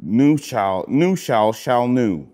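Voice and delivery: ordinary talk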